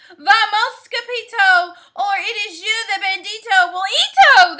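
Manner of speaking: loud, urgent shouting crowd; loud cry. Acted. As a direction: yell it in panic. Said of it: "they cried".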